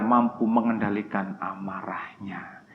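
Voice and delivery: softly whispered close; whispered punchline. Real, not acted. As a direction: lean in and hush it. Sermon punchline dropped to whisper.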